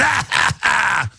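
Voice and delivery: a cackling mock-villain laugh; evil villain laugh. Host mocks globalists with a cartoon villain laugh. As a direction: throw back your head and cackle like a cartoon villain.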